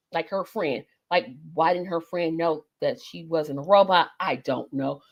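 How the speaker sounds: ordinary talk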